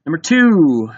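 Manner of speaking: ordinary delivery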